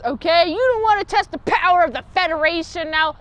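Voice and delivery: high, loud gangster threat; Chicago gangster menace; 1920s mob accent. Retold or imitated. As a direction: threaten the bosses like a mobster.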